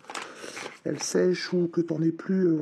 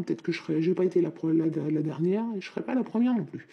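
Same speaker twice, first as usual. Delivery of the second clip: ordinary talk, nothing marked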